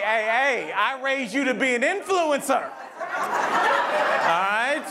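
hammed-up parent urging the kid on; exasperated parent. Parent talking kids out of college, mock exasperated.

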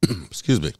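choking on a cough, apologetic. Real, not acted. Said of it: "sorry, I'm choking here".

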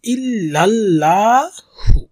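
ইল্লাল্লাহু held long on the final হু; careful tajweed ইল্লাল্লাহু; stretched recitation. Shown as a demonstration. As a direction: recite ইল্লাল্লাহু slowly, leaning on হু. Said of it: "যেহেতু উল্টা পেশ হু".

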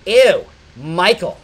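loud, drawn-out disgusted sneer; mock disgust, every word stretched; hateful disgust. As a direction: imitate her recoiling with contempt. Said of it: "hatred in her voice".

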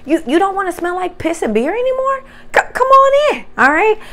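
a hammed-up warm invitation to come in; mock welcoming host.

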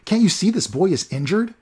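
erupting temper. Acted. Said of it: "Olaf's temper finally erupted".

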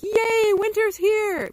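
high, loud happy cheer; excited cheer. Retold or imitated. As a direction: greet the first winter birds with delight. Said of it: "then I'm like".

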